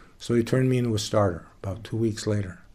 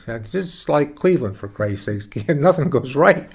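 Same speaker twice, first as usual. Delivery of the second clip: fuming mad; 4 semitones higher, 6 dB louder, slower than usual. Retold or imitated. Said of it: "Fuming mad".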